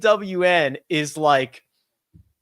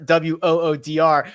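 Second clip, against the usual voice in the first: usual speaking voice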